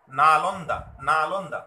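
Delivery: "না লন দা" in three loud, distinct beats; "না লন দা" loudly divided into three syllables, then repeated; three-stroke pronunciation. Shown as a demonstration. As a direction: punch out each syllable of "না লন দা". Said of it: "উচ্চারণটা এইভাবে আসছে".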